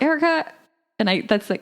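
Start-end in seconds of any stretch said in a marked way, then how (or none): 0.0-0.5 high, sing-song call of her own name. Retold or imitated. Host recalls saying her own name while writing, calling it high. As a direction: call yourself out by name, pitched up. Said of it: "and I'm like".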